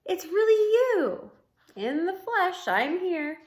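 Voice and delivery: high, loud amazed voice; overjoyed. Acted. Ivan and Bob reunite, high and excited. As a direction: gush in disbelief.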